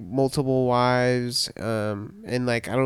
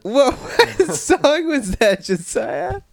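laughing; 12 semitones higher, 4 dB louder, slower than usual. Real, not acted. Laughing at the song Siri played.

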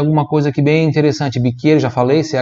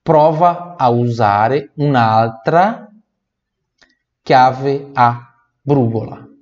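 "prova" stretched, slow delivery; slow model phrase; slower than usual. Shown as a demonstration. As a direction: say it slowly for the class.

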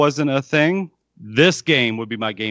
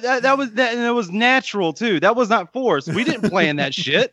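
high, thrilled and amazed; gushing with real delight; excited; 9 semitones higher than usual. Real, not acted. Amazed at their accidental segue.

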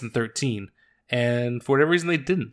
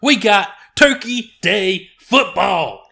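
hype announcer; 7 semitones higher, 6 dB louder, slower than usual. Pretend. Hyping the holiday games.

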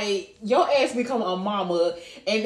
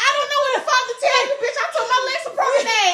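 very high, laughing panic; retelling her panicked reply to the crying friend. Retold or imitated. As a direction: squeal it through laughter.

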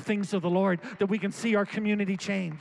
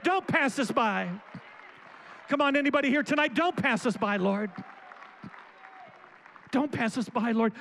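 raised, pleading prayer with repeated appeals; fervent prayer; 4 semitones higher than usual. Acted. Pastor praying over the congregation, fervent and pleading. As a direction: plead with God for the family and the town.

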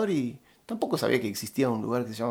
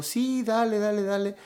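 high-pitched courteous agreement; high, placating string of agreement; politely obliging; 9 semitones higher than usual. Retold or imitated. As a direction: imitate saying yes just to avoid being rude. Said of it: "no quería ser descortés".

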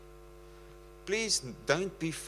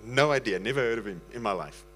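normal talking voice

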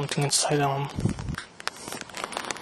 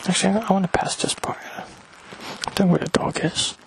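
whispering; 4 semitones higher than usual. Real, not acted. Passing someone on the street.

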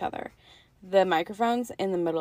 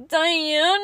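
put-on high loud little kid shout; little nephew calling; 8 semitones higher, 7 dB louder than usual. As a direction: call the cat like a four-year-old. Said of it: "he goes".

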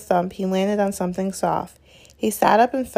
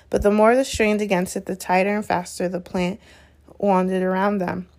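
ordinary delivery

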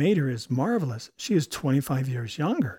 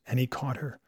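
plain reading voice

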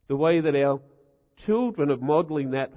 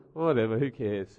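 dismissive; 5 semitones lower, 3 dB quieter than usual. Retold or imitated. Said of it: "it's so dismissive".

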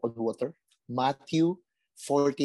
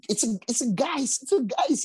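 mock frightened cry; 11 semitones higher than usual.

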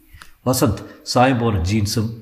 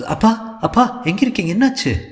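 much higher, urgent frightened calls; panicked; 12 semitones higher than usual. Acted. Iniya on the phone with her missing father, high panicked calls. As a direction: cry out to father in alarm. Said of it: "பதட்டு".

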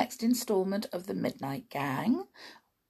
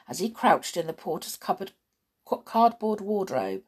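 ordinary delivery

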